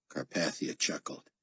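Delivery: plain reading voice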